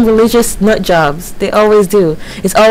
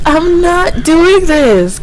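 slow and higher, fearful; frightened refusal; 7 semitones higher, much slower than usual. Real, not acted. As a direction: drag out the refusal in fear. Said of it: "I want to go".

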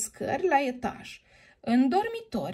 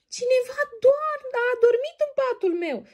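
high, louder whining cub voice; baby bear wailing; 13 semitones higher, 4 dB louder than usual. Acted. Baby bear finds someone in his bed, high wailing. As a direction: wail it like an upset little cub. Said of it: "se boci copilul urs".

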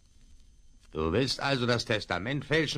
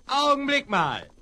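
excited call; 12 semitones higher, 5 dB louder than usual. Acted. Partner calls out during chase.

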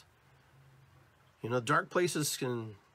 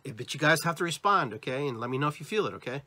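ordinary talk, nothing marked

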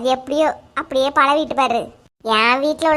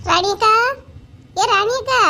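calling out; 6 semitones higher, slower than usual. Acted. Calling neighbour from outside.

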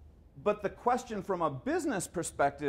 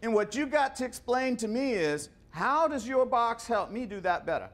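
put-on a folksy Southern executive lecturing a young salesman; Southern accent; 5 semitones higher than usual. Georgia executive scolding a young engineer, in a Southern accent. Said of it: "he had this southern accent, I can't even do it".

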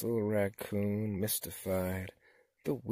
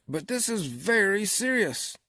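loud, higher, emphatic frog leader; chief frog; 10 semitones higher, 8 dB louder than usual. A narrator's reading. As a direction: insist loudly, taking charge. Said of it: "said the chief frog".

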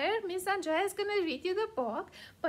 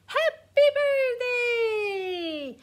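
drawn-out, loud birthday cheer; stretched, gleeful birthday call; excited; 4 semitones higher, 5 dB louder, much slower than usual. Acted. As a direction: sing it out slowly and brightly. Dog gives horse a present.

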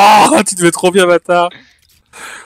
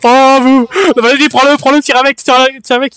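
urgent urging; 6 semitones higher, 4 dB louder than usual. Real, not acted. Urging teammate to shoot.

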